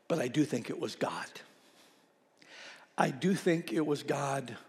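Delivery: soft, low, unhurried pastoral comfort; gentle reassurance. Retold or imitated. Pastor answering a grieving widow about the cardinal, gentle reassurance. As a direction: break it to them tenderly, leaving space between sentences.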